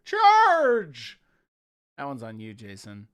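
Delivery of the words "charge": high, loud charge cry; rally the troops into battle. Pretend.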